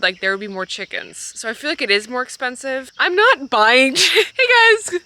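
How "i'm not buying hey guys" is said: higher, louder laughing bit; laughing joke about bringing chicks home. Real, not acted.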